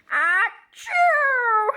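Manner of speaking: loud play-acted achoo; mock sneeze. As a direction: burst out a fake sneeze. Gerald sneezes.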